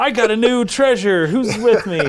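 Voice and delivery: high, bright call to adventure; rally everyone, imitating the captain; excited captain. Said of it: "He says word for word".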